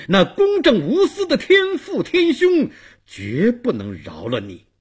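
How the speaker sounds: high, loud, then dropping to a stern close; righteous condemnation. A narrator's reading. Dong Wang invokes Heavenly Father against Bei Wang, righteously condemning him. King condemns his guilty underling.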